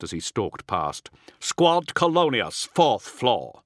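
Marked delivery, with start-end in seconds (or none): 1.4-3.6 slow, raised squad order; lightly acted battlefield command; commanding officer. A narrator's reading.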